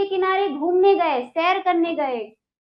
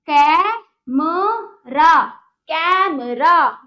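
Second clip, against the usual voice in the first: Camera with the कै drawn out slowly and said louder; stressed first syllable; 5 dB louder, much slower than usual. Shown as a demonstration. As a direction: lean hard and slow on the कै of camera. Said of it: "थोड़ा जोर दे के बोला जाएगा।".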